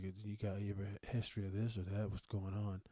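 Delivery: low, soft, unbothered doctor voice; deadpan doctor. Retold or imitated. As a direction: ask as if it's routine paperwork. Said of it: "Just not very excitable".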